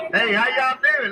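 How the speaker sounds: high, loud call across the river; calling from far away. Real, not acted. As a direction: call out to strangers on the bank. Calling to people on shore.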